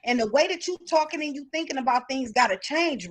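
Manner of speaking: ordinary talk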